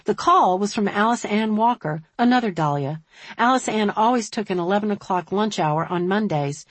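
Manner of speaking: plain reading voice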